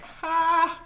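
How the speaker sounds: high, loud single "Ha"; mock audience laugh. Crowd laughing at comic's pain, high mock laugh. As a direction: bark one high fake laugh.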